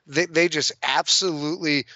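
ordinary talk

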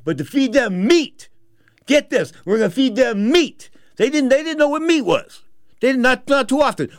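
high, loud and emphatic; emphatic amazement. Real, not acted. Storyteller marvelling that the poor will get meat, amazed.

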